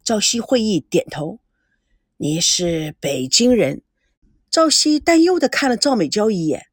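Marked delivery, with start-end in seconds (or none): 2.2-3.8 put-on an elderly grandfather probing slowly, lower; old grandfather. A narrator's reading. Grandfather questions granddaughter's boyfriend, slow and low.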